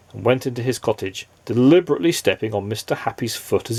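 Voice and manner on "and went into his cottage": usual speaking voice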